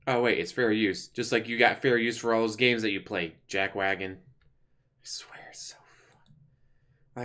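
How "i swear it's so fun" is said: slow unvoiced whisper. Real, not acted. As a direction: breathe it out as a mocking aside. Sarcastic aside after mocking.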